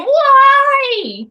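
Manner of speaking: high, loud mock scream of Why. Imitating an upset woman, a loud high scream. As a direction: shriek it out in mock outrage. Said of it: "Because we're not screaming".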